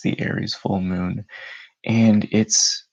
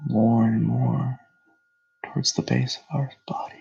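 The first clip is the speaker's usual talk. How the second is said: unhurried meditation voice with long pauses; guided meditation; 7 semitones higher, 4 dB louder than usual. Real, not acted. Host leading listeners through breathing and grounding, meditative delivery. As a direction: guide them into calm, phrase by phrase.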